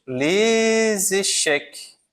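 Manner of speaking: "Les échecs" said very slowly, lez-eshek, with liaison, final C sounded and S silent; slow French liaison. Shown as a demonstration. Teacher writing on the board, slowly spelling out "Les échecs" with liaison. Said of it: "O S eu não pronuncio, mas pronuncio o C final".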